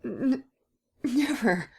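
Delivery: high, stammering refusal; Gérard stutters his refusal in fear; stuttering. Acted. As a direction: stumble over the no. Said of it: "he stuttered".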